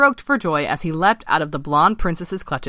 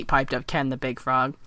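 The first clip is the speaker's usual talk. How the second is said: ordinary delivery, nothing marked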